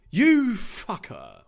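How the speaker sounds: loud, high, drawn-out curse; hammed-up angry cry; acted outrage. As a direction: spit it at the villain. Will curses the villain.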